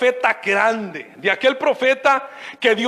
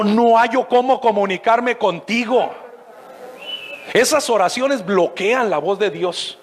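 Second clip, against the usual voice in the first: ordinary talk, nothing marked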